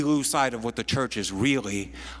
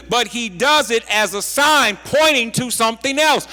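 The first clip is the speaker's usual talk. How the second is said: higher, louder preaching, rising to the point; preaching at full force; 8 semitones higher, 6 dB louder than usual. Acted. Preacher to congregation on Jesus' signs, fervent preaching. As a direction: drive the sermon home with rising fervour.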